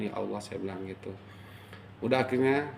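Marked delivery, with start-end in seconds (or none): none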